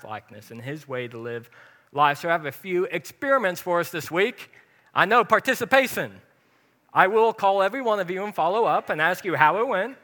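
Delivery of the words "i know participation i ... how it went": louder, playful fake-stern warning to the congregation; mock threat. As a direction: tease them, pretending you'll really check up on everyone. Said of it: "No, I'm totally playing".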